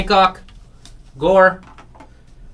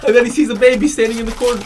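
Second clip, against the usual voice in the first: laughing; 7 semitones higher, faster than usual. Real, not acted. Laughing while retelling the bit.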